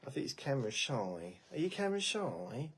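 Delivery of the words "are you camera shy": pet talk. Shown as a demonstration.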